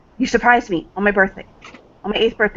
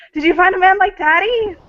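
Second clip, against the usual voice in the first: much higher, excited gushing question; gushing father; 12 semitones higher, 3 dB louder than usual. Acted. Father grabbing daughter's shoulders, high gushing question. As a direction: swoon over her news like a proud dad. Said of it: "he's like".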